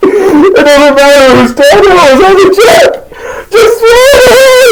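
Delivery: high-pitched mock-hysterical shouting; exasperated yell. Host orders homie to bin the chip, exasperated. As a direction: scream the order in hammed-up frustration. Acted quarrel at peak.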